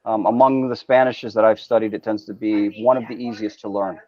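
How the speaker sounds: ordinary delivery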